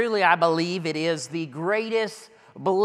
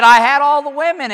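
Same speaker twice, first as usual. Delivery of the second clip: emphatic boast; 7 semitones higher, 11 dB louder than usual. Acted. Preacher voicing Solomon's boasting.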